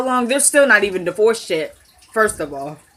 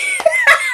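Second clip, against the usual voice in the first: high laugh. Real, not acted. High laugh after impression.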